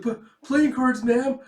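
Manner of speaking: high, loud stammering answer; acted panicky schoolkid; nervous student. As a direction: squeak out a guilty reply. Frightened student caught.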